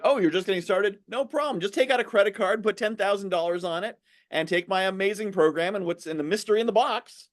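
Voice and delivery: high, breezy mock sales patter; sing-song parody of a seller; pushy salesman. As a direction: pitch it like a glib huckster. Mocking a pushy program seller.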